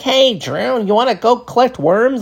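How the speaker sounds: put-on high goofy kid voice; weird kid voice. Mimicking the odd friend Chris inviting him to collect worms, high and goofy.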